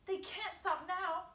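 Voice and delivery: high, slow, emphatic shout; shouting back. A narrator's reading. James answering centipede below, shouting. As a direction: insist loudly, each word weighty.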